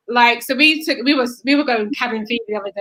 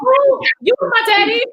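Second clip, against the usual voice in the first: put-on much higher, slow, sweet toddler voice; little boy voice; 12 semitones higher, 4 dB louder, slower than usual. Mother imitating her son greeting his daddy, much higher and slow. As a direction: coo it like a tiny boy greeting his dad. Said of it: "He's like".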